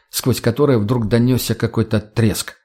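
ordinary delivery, nothing marked

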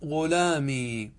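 غلامي stretched slowly, final ي held long and still; drawn-out demo. Shown as a demonstration. Grammar teacher modelling غلامي slowly for students. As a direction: draw out غلامي slowly with a sitting yaa. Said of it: "الأول بقاؤها ساكنة".

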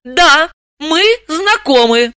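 slow, high, deliberate admission; measured, unhurried yes; firm confirmation. A narrator's reading. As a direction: confirm it slowly in a high woman's voice. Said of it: "подтвердила Далила".

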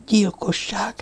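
soft, high, hushed murmur; whispered to herself. A narrator's reading. Girl whispering to herself about the murder, hushed. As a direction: breathe the word out quietly, as if to yourself. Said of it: "suttogta maga elé a lány".

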